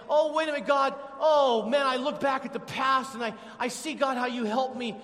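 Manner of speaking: high, loud and thankful; the penny drops in prayer; sudden realization. Acted.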